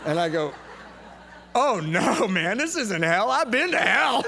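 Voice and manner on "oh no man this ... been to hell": hammed-up loud objection, climbing to a shout; emphatic protest. New guy contradicting a griping coworker, loud overexcited protest. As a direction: build from loud disbelief to a high yell.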